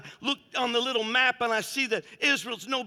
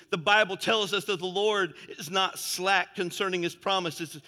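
normal talking voice

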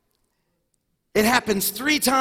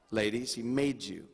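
ordinary delivery

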